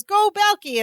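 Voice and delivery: high-pitched mock cheer. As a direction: imitate a girlfriend cheering from the side. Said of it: "And Mary goes".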